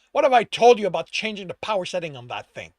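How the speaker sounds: loud, quick rebuke; growled scolding. A narrator's reading. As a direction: scold an underling angrily. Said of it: "he growled".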